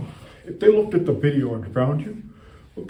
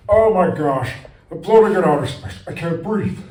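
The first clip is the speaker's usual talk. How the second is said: mock space panic; 4 semitones higher, 6 dB louder than usual. Pretend astronaut panic.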